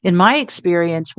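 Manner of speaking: ordinary talk, nothing marked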